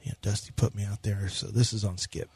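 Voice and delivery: low, soft, defeated mumble; mumbling dejected pitcher. Acted. Co-host playing the pitcher in a gloomy mumble. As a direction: answer like a beaten-down player. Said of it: "I'll be Ryan Presley".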